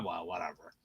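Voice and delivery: quiet throwaway whatever; dismissive. Real, not acted. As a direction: drop it like it doesn't matter. Dismissive shrug after point.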